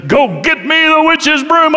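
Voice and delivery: high, loud, drawn-out booming command; Wizard of Oz. Acted. Flashing head orders scared visitors off, booming and loud. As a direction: bellow the order slowly to terrify them. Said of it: "like that".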